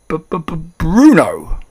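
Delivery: high stammer bursting into a loud name; shocked stammer. A narrator's reading. Father seeing his mouse son, stammering in shock. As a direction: stutter the first sound, then blurt it. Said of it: "stammered Mister Jenkins again".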